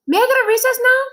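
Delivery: put-on high, loud whiny kid voice; a boy begging to be let out; child Joe. Boy begs his teacher for recess, high and whiny.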